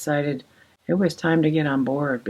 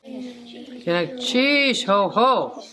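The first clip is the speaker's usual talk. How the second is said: "Gunakchish" drawn out slowly, starting higher; with enthusiasm; 6 semitones higher, much slower than usual. Shown as a demonstration. Teaching viewers to thank with enthusiasm, drawn-out and higher. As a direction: say "Gunakchish, ho, ho" like you mean it. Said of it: "Say it with enthusiasm like you mean it".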